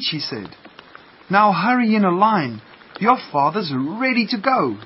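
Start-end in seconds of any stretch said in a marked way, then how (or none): 1.3-4.8 higher, louder motherly call; rally the little ones to get going; eager hurrying-along. A narrator's reading. Mother duck lining up ducklings for a walk, eager.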